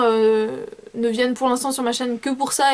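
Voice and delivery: ordinary talk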